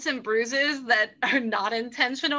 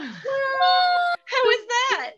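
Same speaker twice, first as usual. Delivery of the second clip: very high, slow, loud squeal; drawn-out high shriek; surprised squeal; 13 semitones higher, 3 dB louder, much slower than usual. Real, not acted. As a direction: shriek it in disbelief. Squeal reading a name.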